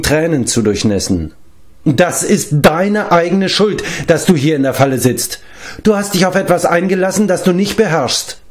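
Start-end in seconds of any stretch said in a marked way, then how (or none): 1.8-8.4 mildly scolding, pitched higher; a touch of exasperated reproach; somewhat indignant. A narrator's reading. Bard blaming the weeping giant, mildly indignant. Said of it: "etwas ungehalten".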